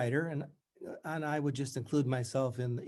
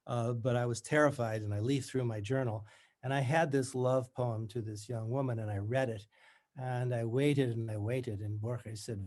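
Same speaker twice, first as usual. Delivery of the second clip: ordinary talk, nothing marked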